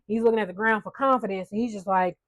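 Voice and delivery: ordinary talk, nothing marked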